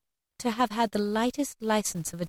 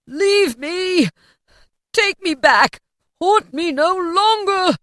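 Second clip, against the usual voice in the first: high, loud, anguished cries; desperate shout; 10 semitones higher, 8 dB louder, slower than usual. A narrator's reading. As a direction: scream at the spirit to leave. Scrooge wrestles the ghost.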